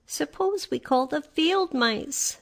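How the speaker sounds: high, slow and careful; suggesting Dorothy. A narrator's reading. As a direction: offer the idea gently. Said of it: "she suggested".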